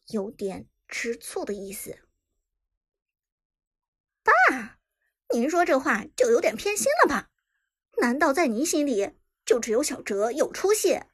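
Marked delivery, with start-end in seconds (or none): 4.2-11.0 out of mouth corner. Acted. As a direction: ask sideways out of a crooked mouth. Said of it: "大姑歪着嘴问道".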